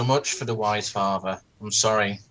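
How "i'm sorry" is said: ordinary delivery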